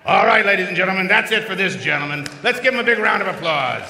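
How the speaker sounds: loud and fast showman call; rally the crowd for applause; announcer call. Real, not acted. Host calling for applause.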